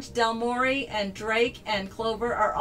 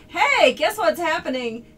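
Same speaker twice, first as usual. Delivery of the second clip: higher and louder eager hey; excited tease; 6 semitones higher, 6 dB louder than usual. Real, not acted. Teasing an announcement.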